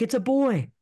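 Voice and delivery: put-on high, bright announcement "It's a boy"; announcing the birth. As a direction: call out the news with joy. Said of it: "they're like".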